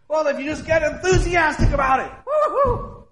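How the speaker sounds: high, whooping hype; exaggerated cheering with a high woo-hoo; mock enthusiasm. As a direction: whoop it up like an overexcited worshiper. Mimics over-enthusiastic worship.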